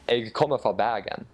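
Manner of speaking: Western Norwegian Bergen dialect with guttural R. Shown as a demonstration. Guest repeating the Bergen dialect for the host. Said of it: "I'll say that again".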